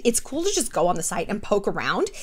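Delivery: ordinary talk, nothing marked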